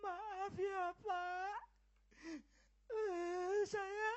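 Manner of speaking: high, soft, crying voice; tearful apology. Acted. Daughter begs her father's pardon, crying. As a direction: apologise through tears.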